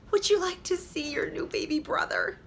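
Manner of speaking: high, sweet mother asking; mother offering her daughter a surprise. A narrator's reading. Mother voiced in story.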